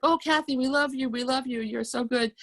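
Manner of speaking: high, sugary coo; mock cooing sweetness. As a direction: pour on fake sweetness to fool the parents. Siblings fake sweetness for parents.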